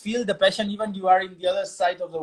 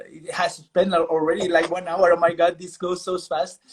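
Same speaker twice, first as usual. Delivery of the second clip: normal talking voice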